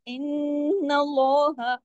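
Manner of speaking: इन्नल्लाह drawn out slowly with a heavy, full lam; Quranic recitation. Shown as a demonstration. Teacher recites इन्नल्लाह to show the heavy lam. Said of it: "लाम को heavy करके पढ़ते हैं".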